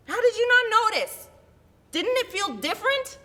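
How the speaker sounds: high, loud, furious scolding; furious mother. Acted. Mother to child over a lost backpack, furious. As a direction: scold your child at full voice, pitched high. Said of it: "My mother was furious".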